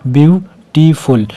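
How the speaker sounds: Beautiful very slow, view, टी, फुल with gaps; split into syllables. Shown as a demonstration. Teacher repeating for the count, beautiful broken as ब्यू-टी-फुल. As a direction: pull beautiful apart into three slow beats. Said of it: "इसमें भी तीन है".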